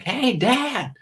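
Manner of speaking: put-on high kid voice calling Dad; imitating son. Son calling from the back of the van, high kid voice. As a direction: pipe up from the back like a boy. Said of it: "he'll be like".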